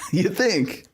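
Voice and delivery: laughing through the question; scornful laugh. Real, not acted. Streamer scoffing at a tweet, laughing incredulously.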